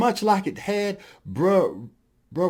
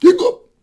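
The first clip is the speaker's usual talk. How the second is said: sudden high, loud hiccup; acted hiccup; 11 dB louder than usual. Elephant after eating peanuts, acted loud hiccup. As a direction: pop out a comic hiccup.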